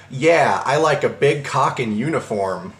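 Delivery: ordinary delivery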